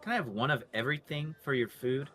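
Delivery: ordinary delivery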